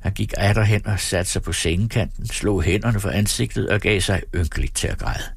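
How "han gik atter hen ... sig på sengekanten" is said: ordinary delivery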